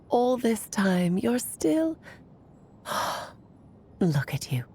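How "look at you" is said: a soft sigh, then lower and softer; weary sigh. Acted. Alice sighing fondly over frail old Anya, softly. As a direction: sigh over her, gently saddened.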